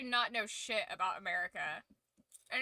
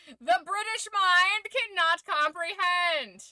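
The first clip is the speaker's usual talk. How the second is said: loud, high, drawn-out declaration; mock proclamation; 8 semitones higher, 10 dB louder, slower than usual. Streamer showing off a giant popcorn box, mock-grandiose shout.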